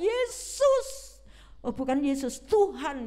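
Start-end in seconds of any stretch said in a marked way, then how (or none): 0.0-1.2 crying out. Acted. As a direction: shout Yesus across the crowd. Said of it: "Dia teriak".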